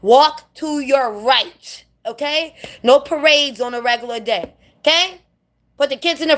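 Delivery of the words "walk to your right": slow, word-by-word instruction; very slow, deliberate delivery; emphatic instruction. Real, not acted. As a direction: spell it out slowly for newcomers. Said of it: "I'm telling them in every language possible".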